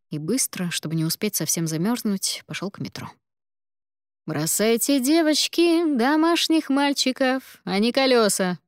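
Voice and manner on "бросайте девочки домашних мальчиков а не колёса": high, slow teasing drawl; mock advice with a laugh; laughing older colleague. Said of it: "рассмеялся Сергей Викторович".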